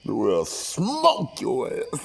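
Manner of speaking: slow, drawn-out mock threat; hammed-up menacing comeback; retold threat. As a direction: threaten playfully, stretching the words high. Acted threat in quarrel.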